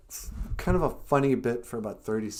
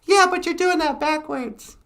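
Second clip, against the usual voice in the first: puppet voice; 10 dB louder than usual. Acted. Puppet Natasha introducing herself, very high and loud. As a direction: squeak it out as the puppet.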